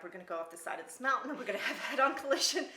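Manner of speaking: fast, rising panic with a laugh; panicked thoughts. Retold or imitated. Passenger fearing a crash, fast laughing panic. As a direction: rush the fear, half laughing. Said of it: "I just remember".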